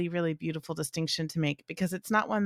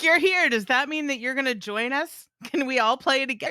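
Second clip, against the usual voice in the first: much higher, bouncy, eager puppy talk; eager dog voice; 8 semitones higher, 4 dB louder than usual. Retold or imitated. Dog inviting mom to join play, high eager voice. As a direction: chirp it like an overjoyed dog. Voicing happy daycare dog.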